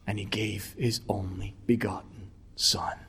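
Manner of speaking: low, soft, whispered reverence; hushed solemnity. Real, not acted. Preacher telling of God giving his son, hushed. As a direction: let it sink to a solemn whisper.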